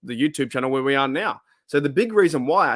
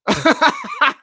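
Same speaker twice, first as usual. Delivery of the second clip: loud high laugh; 13 semitones higher, 6 dB louder than usual. Real, not acted. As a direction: burst of high-pitched laughter. Laughing about the wrong answer.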